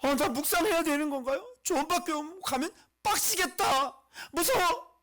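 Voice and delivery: high, loud, whiny mock fear; put-on frightened complaint; mock dread. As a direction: whine in hammed-up alarm. Acting people dreading devotion.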